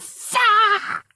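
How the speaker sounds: high, loud yelp of pain and anger; high startled shout, then a demand; pained yell. Retold or imitated. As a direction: yell it like you just got poked in the eye. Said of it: "He's like".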